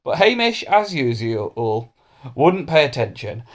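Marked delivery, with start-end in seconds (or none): none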